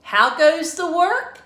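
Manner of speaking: loud, high call from bed; lazy farmer shouting. A narrator's reading. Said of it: "called the farmer".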